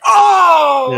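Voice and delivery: loud, high shocked exclamation. Retold or imitated. Co-host reacting to Conan's answer, loud high oh. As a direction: cry out in shock.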